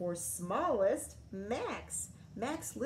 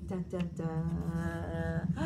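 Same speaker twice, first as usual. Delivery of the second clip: low, slow dun dun dun; mock suspense music with your voice; dramatic sting; 4 semitones lower, much slower than usual. A narrator's reading.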